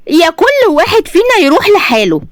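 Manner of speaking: high, defiant ultimatum; forceful ultimatum. A narrator's reading. Wife threatens husband with separation, high and forceful. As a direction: throw it at him, pitch way up. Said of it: "وبصيت له بقوة أكتر وقالت".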